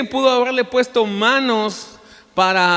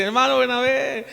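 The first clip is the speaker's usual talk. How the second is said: imitated delighted exclamation; 6 semitones higher than usual. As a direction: greet an old friend in mock delight. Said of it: "el hermano ríe".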